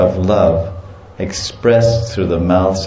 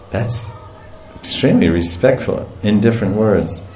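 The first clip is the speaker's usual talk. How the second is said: ordinary talk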